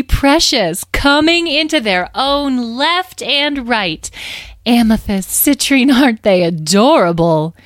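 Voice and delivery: slow, high, swooping adoration; gleeful gushing. A narrator's reading. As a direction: coo and gush with drawn-out delight. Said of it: "clapped their hands together gleefully".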